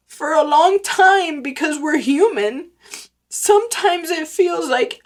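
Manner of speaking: real emotion breaking through the words; crying while asking if the work matters. Real, not acted. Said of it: "I was gonna really try not to cry".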